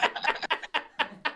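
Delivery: airy high wheezing laugh; silent, helpless wheeze of laughter; breathless laugh. Real, not acted. Host wheezing with laughter at the tease.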